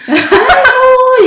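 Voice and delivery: long, loud, high drawn-out cry; mock exclamation. Retelling café owner's reaction, drawn-out mock exclamation. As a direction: stretch it out loudly while laughing.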